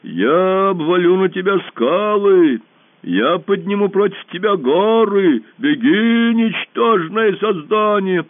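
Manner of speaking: very slow, loud booming boast; boasting monster. A narrator's reading. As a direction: boom out your might. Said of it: "голос чудовища".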